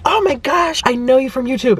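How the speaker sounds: high, thrilled squeal of a fan; excited fan. Retold or imitated. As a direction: gush like a starstruck fan. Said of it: "she was so excited".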